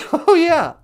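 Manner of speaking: usual speaking voice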